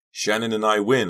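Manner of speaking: high, loud victory call; gleeful shout of victory; triumphant cry. A narrator's reading. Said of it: "I cried".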